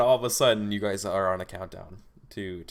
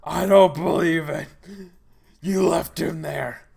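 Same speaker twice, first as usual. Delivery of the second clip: loud, high, slow monster growl; Nemesis monster voice; 8 semitones higher, 9 dB louder, slower than usual. Acted. Monster refusing to believe Bruce, slow loud bellow. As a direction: bellow it out slowly like a wounded brute.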